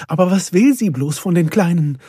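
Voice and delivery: near tears. A narrator's reading. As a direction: ask it upset, on the verge of tears. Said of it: "Perdita war den Tränen nahe". (den Tränen nahe)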